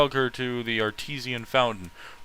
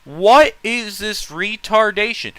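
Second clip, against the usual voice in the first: louder, higher, slow disbelief; outraged repeat; 8 semitones higher, 9 dB louder, slower than usual. Real, not acted. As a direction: shout it out in disbelief.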